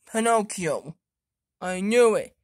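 ordinary talk, nothing marked

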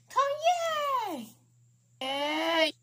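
high, loud happy squeal; excited cheer. Pretend. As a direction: squeal it with joy. Toy cheers about car ride.

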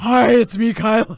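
loud mocking impression of a co-star's villain voice; Kylo Ren. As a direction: boom it out, imitating his put-on voice. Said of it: "your Kylo voice is very similar to your ghost voice".

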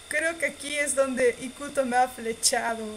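ordinary talk, nothing marked

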